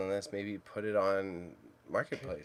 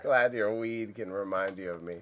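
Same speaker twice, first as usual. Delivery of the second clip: ordinary talk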